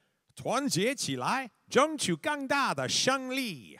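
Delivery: high, slow, rousing slogan chant; propaganda voice. Pretend. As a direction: proclaim it like a revolutionary poster. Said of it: "let me turn on my best propaganda voice".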